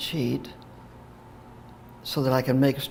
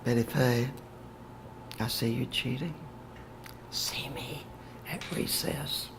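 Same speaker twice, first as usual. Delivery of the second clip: hushed teacher, sinking to a near whisper; very quiet; 2 semitones lower, 10 dB quieter than usual. Retold or imitated. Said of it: "she said very quietly".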